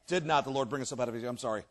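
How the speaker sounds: quick, low, soft reread; self-correcting. Real, not acted. Reader fixing a misread verse, quick quiet correction.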